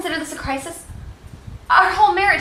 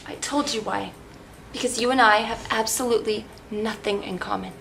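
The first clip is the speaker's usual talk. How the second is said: low, soft, controlled answer; cold and quiet; 8 semitones lower, 7 dB quieter than usual. Acted. Wife explaining divorce to husband, cold and quiet. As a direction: drop the voice and state it with finality. Wife coldly restates grievance.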